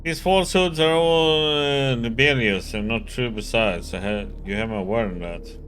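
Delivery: loud, irritated nasal locksmith; annoyed nasally voice. Acted. Voices locksmith character Ryoka.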